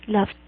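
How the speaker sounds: "Laughed" as one syllable, laft; "Laughed" with a clear final t. Shown as a demonstration. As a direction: end "Laughed" on f plus a released t. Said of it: "va a sonar también como una T".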